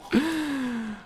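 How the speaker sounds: a sharp theatrical gasp, breath pulled in high; hammed-up gasp of shock; dramatic high gasp. Mourning his lost item with a hammed-up gasp.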